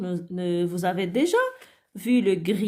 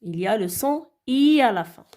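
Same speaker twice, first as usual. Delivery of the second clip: ordinary talk, nothing marked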